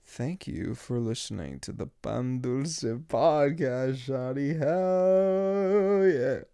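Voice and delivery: slow, drawn-out outro building to a loud held cry; hyped-up sign-off. Acted. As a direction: stretch the words and swell into a big finish. Stretched sign-off, building to shout.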